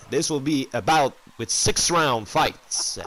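usual speaking voice

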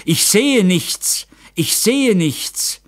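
high, loud, drawn-out cry of horror; anguished shout, far above normal pitch; desperate scream. A narrator's reading. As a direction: cry out in panic, stretching the words. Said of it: "krampfte die Hände in seine Brust und schrie".

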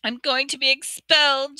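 high, tearful wail; weeping girl, voice high and wet. Acted. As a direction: cry the words out in despair. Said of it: "Ginny wept".

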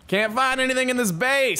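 high-pitched exasperated outburst. Real, not acted. Exasperated searching for lost leads.

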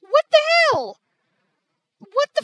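high, loud exasperated cry; real angry outburst. Real, not acted. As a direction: yell it in disbelief. Ruined recording, must redo everything.

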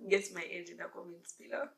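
ordinary talk, nothing marked

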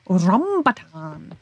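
slow, drawn-out RAMBUTAN with a stretched first syllable; playful relish. Shown as a demonstration. Streamer showing how you have to say that one, playful relish. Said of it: "You have to say that one like that".

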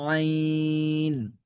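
আইন squeezed from mid-throat, drawn out slow; throaty ain. Shown as a demonstration. Teacher showing the throat sound of আইন, slow. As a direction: press আইন from the throat and hold it. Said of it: "গলার মাঝখান থেকে একটু চেপে পড়তে হবে".

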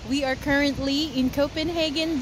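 normal talking voice

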